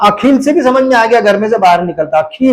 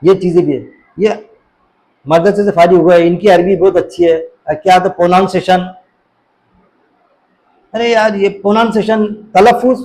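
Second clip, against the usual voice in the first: ordinary delivery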